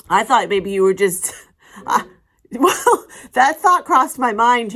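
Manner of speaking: wife protests to husband, laughing loudly; laughing loud defence. Real, not acted.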